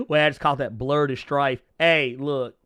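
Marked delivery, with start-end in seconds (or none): none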